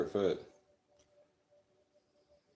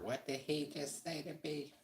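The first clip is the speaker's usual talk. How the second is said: much higher, softer, an indignant wife's voice; offended wife; 11 semitones higher, 7 dB quieter than usual. Retold or imitated. Wife overhearing the insult on the phone, high indignant mock voice.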